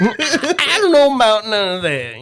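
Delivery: high, slow, laughing put-on voice; mimicked character voice. Host topping friend's impression, high drawn-out denial. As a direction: drag out a high mock denial.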